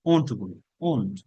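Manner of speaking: ordinary delivery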